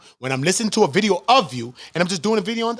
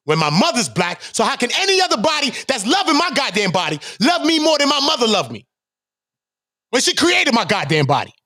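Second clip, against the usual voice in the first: loud, high, indignant ranting; rising fury at the question; heated rant; 9 semitones higher, 4 dB louder than usual. Real, not acted. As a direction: voice climbing in outrage. Heated rant about his mother.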